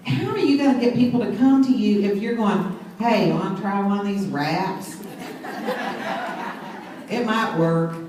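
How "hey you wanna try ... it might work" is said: low, listless, half-hearted pitch; mock a dull sales ask with no spark; flat and unexcited. Shown as a demonstration. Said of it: "No, you got to go".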